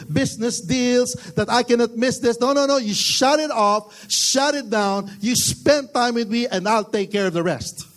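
preaching at full force. Acted. Pastor urging the church to shut off work, forceful preaching. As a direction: drive the point home to the church.

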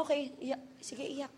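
very soft, resigned go-ahead; hushed, half-hearted consent; reluctant permission. Retold or imitated. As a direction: give in quietly. Retold reluctant permission to cry.

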